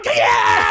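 loud low yelp of being shot; mock death yell. Actor replays yelping when shot in improv. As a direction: cry out as if hit. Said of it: "And I go".

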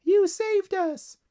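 very high, loud, slow squeal; piping little ladybug cheer; crying-out ladybugs. A narrator's reading. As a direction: squeak it out in gratitude. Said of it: "cried a chorus of ladybugs".

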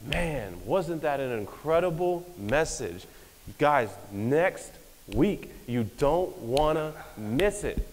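slow, drawn-out imitation of a gushing pastor; mock pastor hype. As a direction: stretch it out like a preacher selling next week. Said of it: "the next week they're like".

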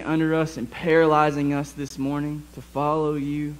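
hushed, earnest prayer from the pulpit; pastoral prayer. Acted. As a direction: pray softly over the congregation. Pastor leads the church in prayer.

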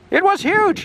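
excited storybook exclamation. Acted. Menzie telling the villagers what he saw, excited. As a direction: exclaim it high to the whole village.

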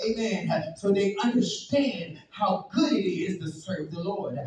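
loud, high, charged preaching; fiery preaching. Acted. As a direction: preach it at full force. Preaching at full force.

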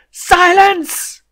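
very high, loud bellowed "Silence!"; furious shout. Acted. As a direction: cut him off with a roar. Overlord cuts off the accountant.

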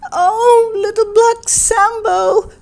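high, drawn-out wail of distress; crying out. A narrator's reading. Mother runs to her son with bad news, crying out. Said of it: "cried she".

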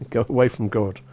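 normal talking voice

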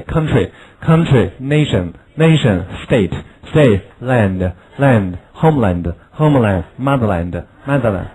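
slow, clear word-by-word drill; slow drill reading. Shown as a demonstration. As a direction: read the list slowly for the class to repeat. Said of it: "我们把这个词再读一下".

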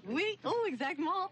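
high, playful put-on French; a high, hammed-up French flourish; mock French. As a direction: tease him by answering in French. [name] teasing the French guest.

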